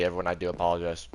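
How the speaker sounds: usual speaking voice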